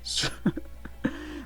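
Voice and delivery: quiet, voiceless chuckle; short laugh. Real, not acted. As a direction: let out a soft, airy laugh. Laughs at own lowered ranking.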